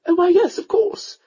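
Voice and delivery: a mother coaxing her son to marry; countess. A narrator's reading.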